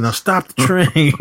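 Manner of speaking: high-pitched mock order; retold command. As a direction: imitate an outlaw giving orders. Robber orders the engineers.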